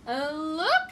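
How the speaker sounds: loud excited call; shouting. Acted. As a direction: shout out to get attention. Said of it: "shouted Fluffalump".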